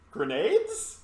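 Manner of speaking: very high, drawn-out, pointed question; incredulous suggestion. Acted. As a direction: stretch it out in mock disbelief. Player urges an obvious alternative.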